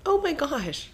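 high-pitched, amused shock; shocked exclamation. Real, not acted. Spouse told she will outlive him, shocked. Shocked at partner's death remark.

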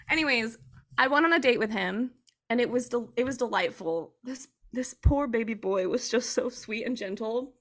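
usual speaking voice

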